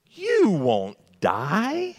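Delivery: very slow, stretched and high, oily snake voice; sly serpent. Retold or imitated. Preacher voices Satan lying to Eve, slow and sly. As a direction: drag it out like a sly tempter. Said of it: "that's the way he said it too".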